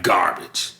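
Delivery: low, quiet dismissal; imitated low, dismissive aside; friend's dismissive verdict. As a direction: drop it low like the friend passing judgment. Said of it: "he'd be like".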